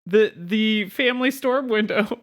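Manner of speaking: put-on high, rushed nerdy girl voice; nervous Velma voice. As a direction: play Velma, flustered. Velma voice continues.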